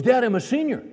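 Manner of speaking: put-on high, pleading teen whine; whiny son. Son asking his dad how he'll get to school, whiny. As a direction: plead like a stranded senior. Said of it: "He goes".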